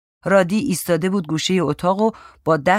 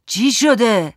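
raised, higher questioning shout; 6 semitones higher, 5 dB louder, much slower than usual. A narrator's reading. Grandpa demanding to know what happened, shouted. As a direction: call out to find out what's going on. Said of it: "باباجی داد زد".

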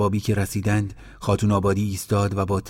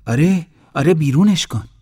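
much louder, higher insistent order; angry demand; 8 semitones higher, 7 dB louder than usual. Acted. Aunt ordering Abbas to throw the dog out, loud. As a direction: drive the dog out now.

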